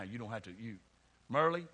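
normal talking voice

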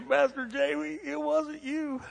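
hammed-up crying apology; mock blubbering. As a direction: ham up a tearful confession. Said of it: "he's gonna start crying his eyes out".

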